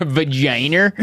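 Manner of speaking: "vagina" ending "-er", rising; questioning "vagina" with an R on the end; added R. Shown as a demonstration. Host correcting where the R goes, R ending "vagina". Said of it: "There's no R in vagina".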